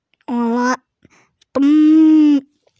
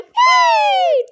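squeal; 4 dB louder than usual. Acted.

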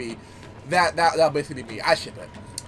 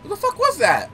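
startled; 13 semitones higher, 5 dB louder than usual. Real, not acted. Startled by game event.

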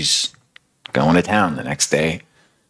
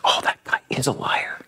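whispered realization; 8 semitones higher, 5 dB quieter than usual. Retold or imitated. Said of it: "She's like".

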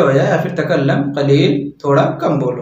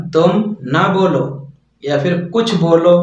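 ordinary delivery

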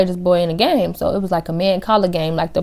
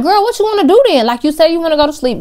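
exasperated; 10 semitones higher, 5 dB louder, faster than usual. Retold or imitated. Exasperated with drunk friend.

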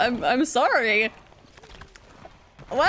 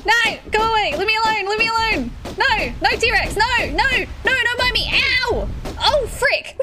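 scared; 6 semitones higher than usual. Real, not acted. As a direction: panic while running away. Fleeing a T-Rex in game.

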